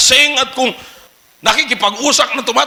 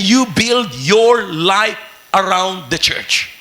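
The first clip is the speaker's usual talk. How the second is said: slow emphatic; 4 semitones lower, much slower than usual. Real, not acted. Preacher stressing main point.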